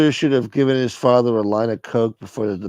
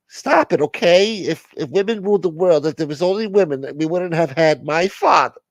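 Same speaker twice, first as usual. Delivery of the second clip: insistent caller; 7 semitones higher than usual. Acted.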